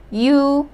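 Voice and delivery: "U" said alone as a long yoo; vowel demo. Shown as a demonstration. Teacher showing how to pronounce the vowel, vowel demo. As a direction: hold "U" out as one clean vowel. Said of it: "simply pronounce the vowel U".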